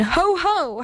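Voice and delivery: howled jeer. A narrator's reading. Evil things crowding round the moon, mild high howl. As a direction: give a small high howl of mockery. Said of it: "howled the things again".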